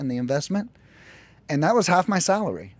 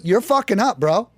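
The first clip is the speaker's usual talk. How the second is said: higher, louder blunt scolding; tough restaurant boss; 6 semitones higher, 3 dB louder than usual. Retold or imitated. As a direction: bark it like a kitchen boss. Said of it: "my boss went to me".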